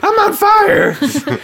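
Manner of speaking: high, loud, slow laughing wail; panicked cartoon voice. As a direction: wail like a burning toy. Voicing panicked steam creature.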